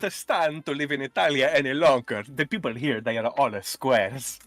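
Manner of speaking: hammed-up Italian accent, theatrically fed up; mock Italian accent. Friend acting the man fleeing Italy for London, mock Italian accent.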